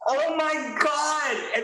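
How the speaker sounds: high, slow, loud exclamation of disbelief; shocked outcry. Retold or imitated. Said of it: "And I'm sitting there going".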